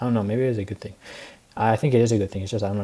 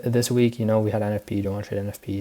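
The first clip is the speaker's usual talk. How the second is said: normal talking voice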